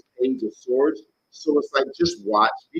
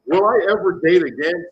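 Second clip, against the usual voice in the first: exaggerated plea with a rising end; desperate; 4 semitones higher, 6 dB louder than usual. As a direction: mock-desperate wail, higher and louder. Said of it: "That sounds so fucking desperate".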